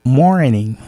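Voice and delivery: ordinary talk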